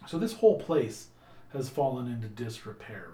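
usual speaking voice

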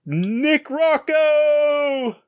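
long, high, drawn-out melodramatic cry; mock anguished yell, hugely drawn out; Darth Vader. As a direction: wail the name, stretching the last vowel. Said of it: "Batman does a, a Darth Vader and sa-, and yells".

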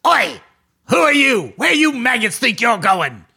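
loud, barking orc challenge; orc patrol leader. Acted. Orc leader challenging the sneaking party, loud and menacing. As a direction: bellow at the intruders like an orc sergeant. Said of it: "you hear a voice say".

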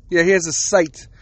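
ordinary talk, nothing marked

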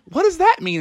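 high-pitched baffled question; puzzled frogs. Acted. Frogs question the goblin girl, high and puzzled. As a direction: ask in a squeaky, confused character voice.

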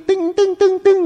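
high-pitched twanging string imitation; vocal "tueng" of a flicked string; plucked-string twang. Shown as a demonstration. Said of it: "ดึงเอ็นมาแล้วก็ดีด".

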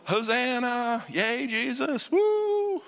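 high, slow hammed-up cheer ending in a squealing woo; mock cheering. Pastor mimics a worshipper cheering for Jesus, hammed-up and high. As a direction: ham up a crowd cheering Jesus. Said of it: "is going".

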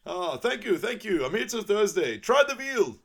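milk the applause like a lounge comic; Donkey's stand-up voice. Retold or imitated. Donkey playing the crowd like a comic.

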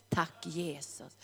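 breathy whispered thanks, slow; whispered prayer. Real, not acted. (viskad tacksägelse)

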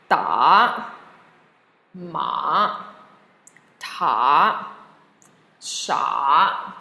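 dipping third tone on "Da, ma, ta, sha". Shown as a demonstration. Teacher modelling for students to repeat, "Da, ma, ta, sha" in dipping tone. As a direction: dip each of "Da, ma, ta, sha" low, then lift it. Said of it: "the dipping tone".

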